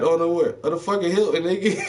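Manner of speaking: high, loud, laughing disbelief; a high, loud "Oh, no, where?" in amazement; shocked disbelief. Retold or imitated. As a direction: squeal it out in shock, half laughing. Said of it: "She's like".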